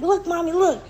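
put-on high eager little-kid voice; the boy showing off his drawing; imitating her son. Mother voices her son's words.

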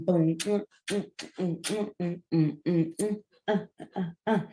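ordinary talk

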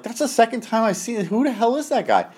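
high, puzzled retold question; imitating alarmed co-worker. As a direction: ask as a kid puzzled by a stranger.

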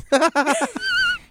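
shrieking laugh, very high; very high squeal laugh. Real, not acted. Cohost cracking up at the joke, squealing laugh.

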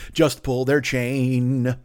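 very slow, drawn-out correction; slowed lyric fix. Pretend. Fixing the parody lyric, very slow drawn out. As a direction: stretch the lyric out. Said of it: "or must pull their chain".

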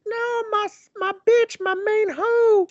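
mock a girl wailing for her friend; hammed-up falsetto cry. Sarah cries for Hannah.

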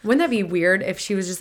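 normal talking voice

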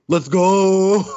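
loud, high pumped-up "let's go"; imitating a dumb frat-boy cheer; hyped bro. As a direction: fire it off like a gym bro getting hyped. Hyped bro reply in retold scene.

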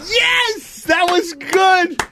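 high, drawn-out whoop of approval; real excited outburst; delighted cheer. Real, not acted. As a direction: cheer a friend's performance. Host cheers the impression.

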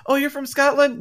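high, surprised voice of a stranger; surprised question. Retold or imitated. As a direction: asks it as if amazed. Imagined stranger asking in surprise.

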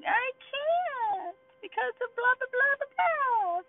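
very high, drawn-out mock whine; whining. Speaker mocking themselves in that moment, whining. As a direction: parody your own poor-pitiful-me whine. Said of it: "verse seven is what I sound like".

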